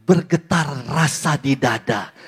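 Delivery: slow and drawn-out, rolling the r; swooning, slow recital. Acted. Reader of a sweetheart's letter, mildly swooning. Recalls reading love letters.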